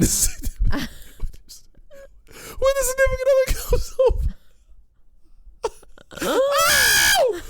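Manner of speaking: laughing so hard it hurts; high, crying laugh breaking through the words; laughing through pain. Real, not acted. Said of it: "Sorry, this hurts. It hurts to laugh".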